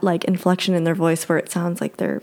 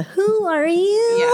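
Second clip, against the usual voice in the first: very high, drawn-out kiddie mimicry; slow, high echo of a ghost child; imitated child's voice; much slower than usual. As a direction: stretch each word in a high child voice. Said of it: "It's just so clearly a child's voice".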